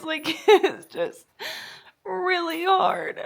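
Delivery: tearful, barely getting it out; real crying. Real, not acted. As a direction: let the voice crack and crumble. Crying about breakup.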